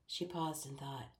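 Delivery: ordinary delivery, nothing marked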